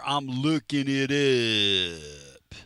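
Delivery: slow shop teacher catchphrase; drawn-out teacher impression; Dave Sander. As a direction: stretch the final word very long. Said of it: "and to Dave Sander".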